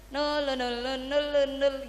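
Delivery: lightly bobbing, repeated noises; approaching rooster. Retold or imitated. Preacher acting rooster nearing hen, bobbing sounds. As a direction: imitate a rooster sidling up, rhythmically.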